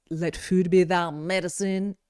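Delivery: put-on a mild foreign accent on an ancient saying, slow; lightly accented old Greek sage, unhurried; different accent. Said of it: "in a different accent though".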